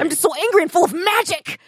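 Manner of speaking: mock dramatic fury; hammed-up angry magician. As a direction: play a melodramatic brooding magician. Mock melodramatic rival.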